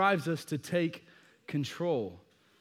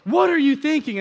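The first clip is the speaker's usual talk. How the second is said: loud, higher frantic plea; frantic pleading; 8 semitones higher, 8 dB louder than usual. Retold or imitated. Dad pleading with his daughter after her fall, frantic and loud. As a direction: beg her to listen, at full volume.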